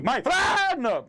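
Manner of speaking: high, loud yelled greeting; screaming. Shown as a demonstration. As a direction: yell it out to test the mic. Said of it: "What if I scream".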